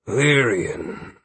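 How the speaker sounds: mildly weary sigh. A narrator's reading. Aldwin greeting Lyrian as the friends depart, sighing. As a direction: breathe the name out as a drawn sigh. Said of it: "he sighed".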